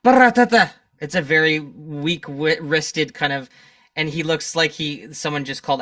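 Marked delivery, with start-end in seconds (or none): 0.0-0.7 loud, high machine-gun noise; spoken rat-a-tat at raised pitch; imitated machine gun. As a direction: fire off a burst of mock gunfire. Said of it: "he's just like".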